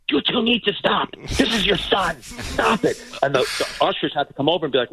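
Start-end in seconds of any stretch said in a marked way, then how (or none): 0.1-3.0 high-pitched mock scolding; imitated exasperated stepmother. Stepmother tells the quarrelling parents off, mock exasperated. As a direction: break up a squabble in a higher, put-on voice.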